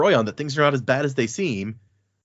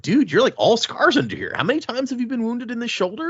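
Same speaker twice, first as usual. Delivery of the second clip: higher, incredulous doctor voice; amazed surgeon; 12 semitones higher than usual. Acted. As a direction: play the stunned surgeon counting scars. Surgeon voiced examining old scars.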